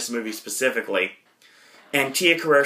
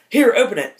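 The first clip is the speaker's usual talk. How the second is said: put-on high, louder pushy girlfriend voice; eager girlfriend; 10 semitones higher, 5 dB louder than usual. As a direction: hand over the gift with eager push. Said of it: "all right".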